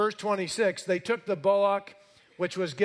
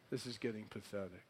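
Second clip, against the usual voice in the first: low, very quiet aside; unimpressed onlooker; 8 semitones lower, 15 dB quieter, faster than usual. Acted. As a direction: toss it off softly while lounging back. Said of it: "sitting back drinking a lemonade like".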